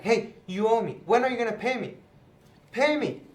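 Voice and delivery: mock bully shaking down a debtor; mad demand. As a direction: debtor turns on the man who owes him, angry. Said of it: "goes up to him all mad".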